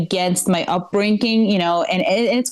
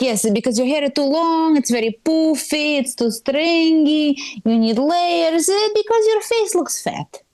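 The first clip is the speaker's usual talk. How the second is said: Eastern European mom; 7 semitones higher than usual. Retold or imitated. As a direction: climb higher with each criticism, heavy accent. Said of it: "That's the accent".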